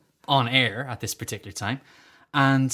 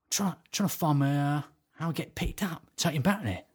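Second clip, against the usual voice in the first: Terry Venables; 7 dB quieter than usual. Retold or imitated. Man working out where his lift is, soft halting impression. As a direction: imitate a man vaguely thinking aloud, hushed. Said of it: "I'm walking with Terry Venables".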